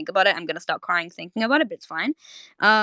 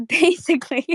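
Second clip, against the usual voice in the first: laughing; 10 semitones higher, 4 dB louder than usual. Real, not acted. Laughs through the word.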